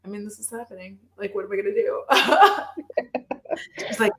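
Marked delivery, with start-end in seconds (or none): 0.0-2.0 resigned laughing aside. Retold or imitated. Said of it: "I'm like". 2.1-3.8 real laugh. Real, not acted. Laughing at mom barging in.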